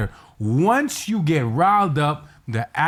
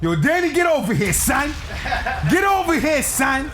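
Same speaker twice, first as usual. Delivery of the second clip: high, excited accented call; Danny's accent; 11 semitones higher than usual. Shown as a demonstration. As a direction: call someone over in a pitched-up put-on accent. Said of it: "My accent starts to sound like Danny's accent".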